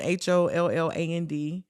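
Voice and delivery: ordinary talk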